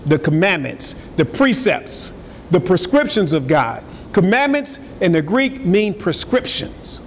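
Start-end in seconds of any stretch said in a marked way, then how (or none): none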